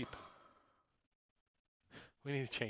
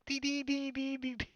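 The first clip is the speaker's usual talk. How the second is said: high electronic dee dee beeps; walkie-talkie beeps; 11 semitones higher than usual. Retold or imitated. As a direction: beep like an old walkie-talkie. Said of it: "like the nineteen eighty walkie-talkie".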